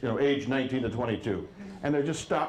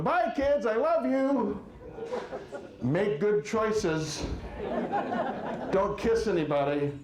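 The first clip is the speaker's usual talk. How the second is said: embarrassing dad call; 8 semitones higher, slower than usual. Retold or imitated. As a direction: call out sweetly to embarrass them. Dad embarrassing kids at school.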